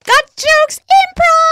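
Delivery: high loud slow announcer yell; announcer shout. Shown as a demonstration. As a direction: boom it like a ring announcer.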